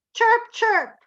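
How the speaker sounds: high chirping; bird chirps. Shown as a demonstration. Teacher showing a bird chirping high. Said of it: "in English goes".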